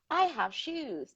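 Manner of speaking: put-on high, slow little boy; lightly voiced toddler boasting; a touch of little boy voice; child voice. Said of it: "the little boy is like".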